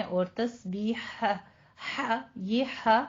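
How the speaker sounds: तस्बीहा slow, हा breathed from the mid throat; throat sound demo. Shown as a demonstration. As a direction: draw out the हा in तस्बीहा. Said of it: "वस्ते हलक से".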